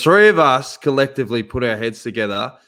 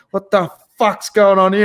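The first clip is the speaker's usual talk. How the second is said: loud, higher mock yell of frustration; mock frustrated outburst; 6 semitones higher, 5 dB louder than usual. [name] losing it at his bad internet, mock yell. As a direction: blurt it out like a fed-up guest. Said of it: "you've gone".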